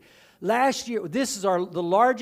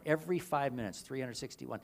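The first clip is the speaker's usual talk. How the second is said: hushed repeat; 5 semitones lower, 10 dB quieter than usual. Shown as a demonstration. Preacher repeating the toll quietly to let it land. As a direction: echo the number softly, lower and quieter. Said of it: "same statistic repeated softly".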